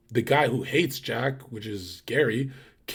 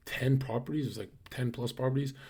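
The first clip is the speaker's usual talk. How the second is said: ordinary talk, nothing marked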